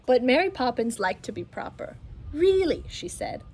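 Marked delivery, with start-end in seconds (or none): 2.3-2.8 prim reproach. Acted. Mary scolds proper.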